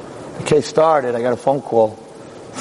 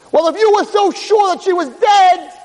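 high, slow, ringing oratory; thundering accusatory challenge; 3 dB louder, slower than usual. Acted. Lawyer confronting the jury with their doubt, high and forceful. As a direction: press the point home at full pitch.

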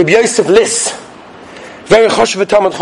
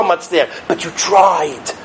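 slow, softer and lower; soft reassurance; 6 semitones lower, 4 dB quieter, slower than usual. Acted. Preacher comforting listeners, slow and soft. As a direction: ease it down gently, slowly.

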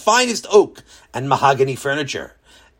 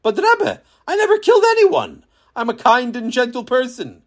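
much higher, pleading and flustered; flustered protest; 9 semitones higher than usual. A narrator's reading. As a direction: protest your innocence in a panic. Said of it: "Beryl stammered".

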